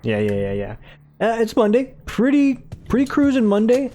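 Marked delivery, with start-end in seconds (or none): 1.2-3.9 high lilting sing-song. Acted. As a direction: lilt it up high and bright.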